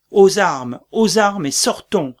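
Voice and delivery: rally the soldiers at full voice; call to arms. Acted. Macbeth roars at his soldiers to take up arms.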